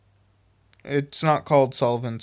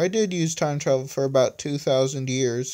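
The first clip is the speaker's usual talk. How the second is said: usual speaking voice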